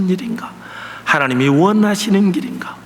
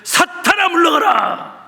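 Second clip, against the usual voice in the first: high sharp rebuke; mock rebuke; 10 semitones higher, slower than usual. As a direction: fling it at the congregation like a sudden scolding. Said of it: "아마 내가 여러분을 향해서".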